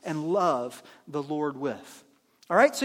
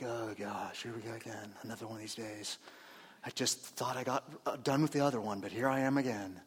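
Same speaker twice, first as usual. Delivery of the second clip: much lower, much softer weary groan; dragging grump; 10 semitones lower, 10 dB quieter than usual. Retold or imitated. Pastor mimics a miserable morning person, low and groaning. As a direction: sag like you hate mornings. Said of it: "you're dragging out".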